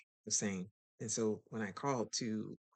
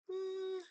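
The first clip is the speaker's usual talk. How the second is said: very high knowing mm; pointed high hum; sassy mm. Retold or imitated. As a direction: hum it like you've made up your mind. Sassy reply before leaving.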